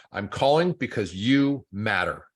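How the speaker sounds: "I'm calling because you matter" said slowly, each word weighed; slow and deliberate. Shown as a demonstration. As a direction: read the script line slowly, meaning it. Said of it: "I would put that on a piece of paper".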